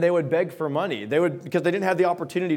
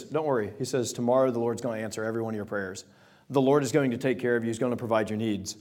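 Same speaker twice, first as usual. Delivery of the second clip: softer and quicker, calming the crowd; reassuring; 6 semitones lower, 5 dB quieter, faster than usual. Retold or imitated. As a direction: gently tell them not to worry. Elisha comforting the starving people.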